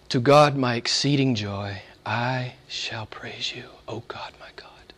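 soft, slow, fading whisper; hushed psalm reading. Acted. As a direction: breathe the verse like a prayer. Psalm whispered, then repeated aloud.

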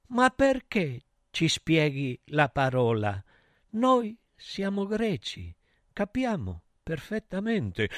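soft, halting character voice; god of stupidity. Acted. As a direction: object quietly, word by word. God of stupidity questions Apollo.

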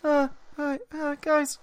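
high nervous stammering squeak; mock Morty stammer. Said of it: "you sound like fucking Morty".

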